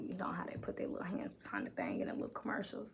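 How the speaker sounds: fast, soft whisper. Retold or imitated. Whispering to viewers about the commercials. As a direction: lean in and whisper it quickly.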